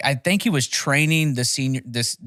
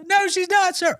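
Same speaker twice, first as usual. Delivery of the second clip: high-pitched mock protest yell; screaming recruit. Recruit contradicting the drill instructor about his wife, high mock scream. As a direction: imitate a recruit yelling back in a very high voice.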